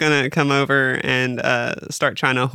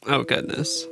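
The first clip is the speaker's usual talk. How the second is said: usual speaking voice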